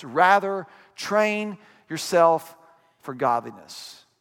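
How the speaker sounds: slow, deliberate stress on "rather train yourself"; slow emphasis. Shown as a demonstration. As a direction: lean into each word, slowly. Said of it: "look at these next five words".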